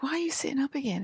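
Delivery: quick, high mimicked question; puzzled questioning. Acting out others' puzzled question.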